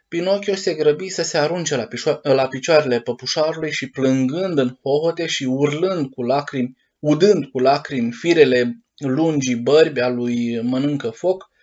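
ordinary delivery, nothing marked

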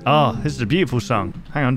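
ordinary delivery

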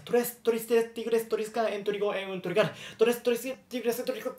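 tongue twister. Shown as a demonstration. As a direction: rattle it off without pausing. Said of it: "hablar rápido".

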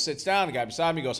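ordinary talk, nothing marked

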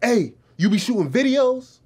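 high, loud imitation of an excited stranger; Kareem. Stranger spots the big camera and asks, mimicked loud and high. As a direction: mimic him butting in eagerly. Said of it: "Kareem's like".

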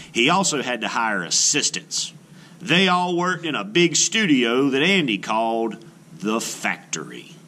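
storybook read-aloud, gently expressive; children's book narration. Acted. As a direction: read it like a picture book to a class. Reads picture book aloud.

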